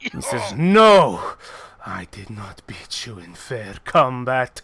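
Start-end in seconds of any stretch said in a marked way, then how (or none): 0.6-1.3 loud, drawn "no"; emphatic denial. Acted. Fighter rejects the dwarf's concession, loud. 1.8-4.6 low, solemn character voice; grave fighter. Acted. Fighter tells his rival it was unfair, low and grave.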